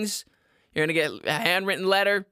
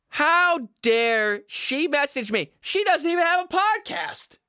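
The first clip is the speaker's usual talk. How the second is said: indignant outburst; 7 semitones higher than usual. Acted. Podcaster outraged at mother's text, indignant outburst. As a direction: pitch climbing high in outrage.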